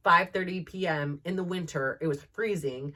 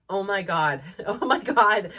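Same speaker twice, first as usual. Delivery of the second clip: laughing; 4 dB louder than usual. Real, not acted. Vlogger recalling the Disneyland sickness, laughing. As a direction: giggle helplessly while reliving the moment. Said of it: "this is so embarrassing".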